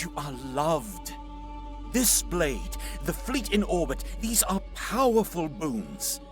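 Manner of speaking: rally them with ardent conviction; impassioned persuasion. Acted. Warrior praises the gods' gifts to doubting seer, impassioned. Urging seer to trust gods.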